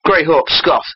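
ordinary delivery

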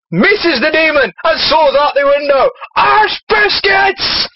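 Irish shout climbing to a high cry; Father Jack. Acted. Narrating the failed kick as drunken priest, Irish outburst. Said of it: "Father Jack".

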